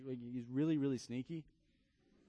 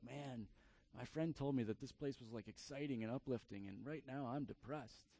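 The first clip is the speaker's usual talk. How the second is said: much softer, under-the-breath aside; deflated newcomer; 3 semitones lower, 10 dB quieter than usual. Retold or imitated. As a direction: mumble it low like a let-down visitor. Voicing a disheartened newcomer.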